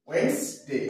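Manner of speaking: ওয়েডনেসডে said as wenz-day; two parts, wenz-day, no first D in ওয়েডনেসডে; correct silent D. Shown as a demonstration. Teacher repeats the correction for students, ওয়েডনেসডে as wenz-day. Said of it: "ডি এর উচ্চারণটা নেবো না".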